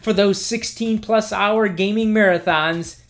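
mock commercial announcer voice; ad read. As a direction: sell it like a TV ad. Reads sponsor ad.

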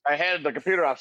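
ordinary talk

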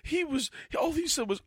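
high-pitched offended shopper voice, laughing through it; mock customer rant. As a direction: play the outraged customer telling Linda. Voices the customer telling her friend.

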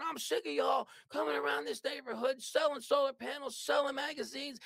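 high, shouted rant at a pushy salesman; furious yelling. Retold or imitated. Angry homeowner yells at door-to-door salesman, shouting high. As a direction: yell him off your street, fed up. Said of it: "He's yelling and screaming".

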